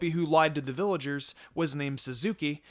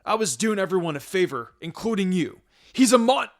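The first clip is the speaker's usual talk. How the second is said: defiant shout; 6 semitones higher, 8 dB louder than usual. Acted. Traitor defends himself, cut off.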